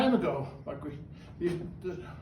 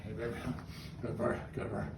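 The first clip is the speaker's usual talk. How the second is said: much lower, softer praise for the bird; 9 semitones lower, 10 dB quieter than usual. Real, not acted. Owner cooing praise to his talking bird, low and soft. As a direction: coo gently to a good bird.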